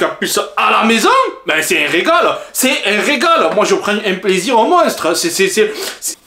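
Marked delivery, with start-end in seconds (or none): none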